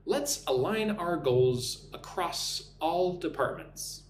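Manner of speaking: slow, spaced phrases with clear words; measured dictation pace for learners to copy; slow repeat-after-me model. Shown as a demonstration. Teacher giving a sentence to repeat, slow and clear. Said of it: "Listen and repeat after me".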